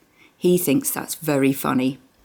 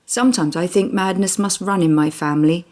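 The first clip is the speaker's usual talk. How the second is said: ordinary delivery, nothing marked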